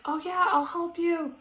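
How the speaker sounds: high-pitched sweet, willing helper; sugary retold reassurance. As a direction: imitate a kindly sister-in-law, voice lifted high. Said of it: "so sweet. She's like".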